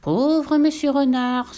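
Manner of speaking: high, drawn-out sigh of pity; sighing piglet. A narrator's reading. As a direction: sigh slowly over the poor fox. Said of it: "soupira le porcelet". (long soupir apitoyé de porcelet)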